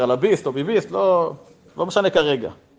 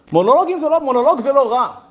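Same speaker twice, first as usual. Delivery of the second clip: raised, louder clarification; emphatic disclaimer; 8 semitones higher, 4 dB louder than usual. Real, not acted. Speaker qualifying his point on monologues, emphatic disclaimer. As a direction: jump in high to head off misunderstanding.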